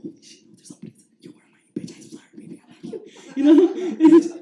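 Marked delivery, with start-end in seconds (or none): 0.0-3.0 barely audible, breath-only whispering; re-enacted scared whispers; frightened whisper. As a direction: whisper in hushed panic. Shocked group looking at each other.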